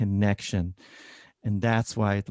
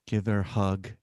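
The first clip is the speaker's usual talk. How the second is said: ordinary delivery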